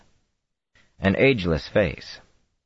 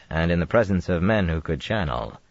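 usual speaking voice

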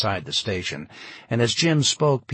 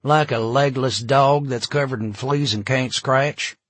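louder, higher Southern folksy voice; 4 semitones higher, 6 dB louder than usual. A narrator's reading. Police chief answers with a colorful saying, Southern voice. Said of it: "yet another of Tom's colorful southernisms".

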